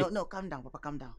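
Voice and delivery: soft, low calm down; soothing. Acted. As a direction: settle the father gently. Son soothing angry father.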